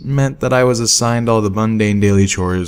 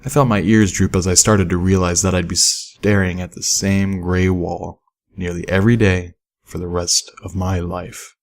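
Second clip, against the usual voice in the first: ordinary delivery, nothing marked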